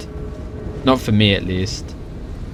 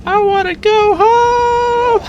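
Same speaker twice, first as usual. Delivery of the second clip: put-on ear-piercing, very high, slow robot-girl wail; whiny little sister bot voice; much slower than usual. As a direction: wail it like a tiny robot sister crying to leave. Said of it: "little sister bot voice".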